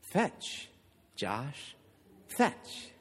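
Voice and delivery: soft, breathy, teasing command; mock-sultry woman's murmur. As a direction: coo it slowly, word by word, seductively. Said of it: "in a very sultry voice".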